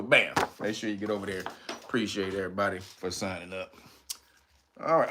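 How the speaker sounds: usual speaking voice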